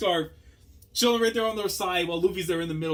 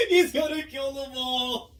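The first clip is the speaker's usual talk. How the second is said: shocked shout; 11 semitones higher than usual. Real, not acted. Seeing Kaido rampage, high shocked shout. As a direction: yell it out in shock.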